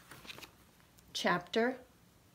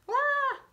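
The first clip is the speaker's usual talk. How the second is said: mock scream. Reacting to fly swatter.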